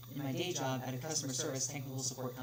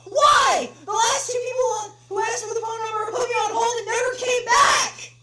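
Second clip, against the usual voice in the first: furious caller yelling, much higher and louder; angry shout; 16 dB louder than usual. Acted. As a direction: blast the complaint at the clerk. Corrected take of same line.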